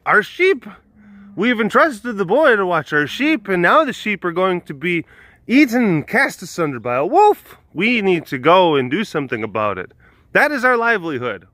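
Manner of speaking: high-pitched, declaiming townsfolk in mock alarm; hammed-up, formal indignation; alarmed shepherds. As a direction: protest like an outraged villager in a fable.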